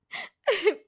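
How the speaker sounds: high wailing burst of sobbing; loud fake sob. Ritu caught cheating, bursts into a high fake wail. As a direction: break into a showy wail to dodge blame. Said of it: "जोर जोर से रोने लगती है".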